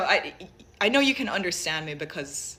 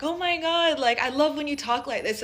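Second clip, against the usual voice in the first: gushing friend; 9 semitones higher, 4 dB louder than usual. Retold or imitated. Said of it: "my American friends were like".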